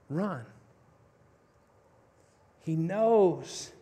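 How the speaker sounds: ordinary talk, nothing marked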